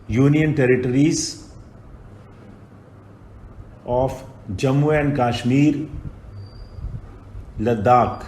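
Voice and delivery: very slow, word by word for writing it down; dictating at a crawl; slow dictation. Shown as a demonstration. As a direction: spell it out slowly so they can write. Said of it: "dictation, slowed for writing".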